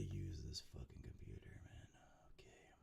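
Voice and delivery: whispered frustrated aside; whispering. Real, not acted. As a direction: hiss it under your breath.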